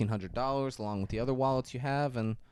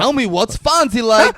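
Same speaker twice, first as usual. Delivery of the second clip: much higher and louder demand; insistent quizzing; 13 semitones higher, 13 dB louder than usual. Retold or imitated. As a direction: press her for the answer. Jules quizzing Honey Bunny.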